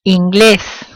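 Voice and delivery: Inglés, punching GLÉS; Inglés with a firm second syllable; final-syllable stress. Shown as a demonstration. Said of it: "stress comes at the end".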